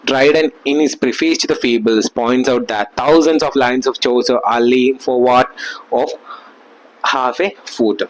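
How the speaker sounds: reading each word out very slowly, like dictation; slow textbook reading. Acted. Teacher dictating notes to students, very slowly. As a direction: read the notes out for the class to copy. Reads English text aloud slowly.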